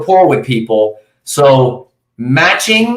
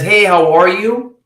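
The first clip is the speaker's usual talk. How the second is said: higher-pitched hello with a rise at the end; higher greeting; 6 semitones higher than usual. Retold or imitated. Caller greeting a stranger, a little higher. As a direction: lift the greeting up. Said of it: "it's a little bit higher than".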